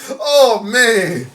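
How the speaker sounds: ordinary talk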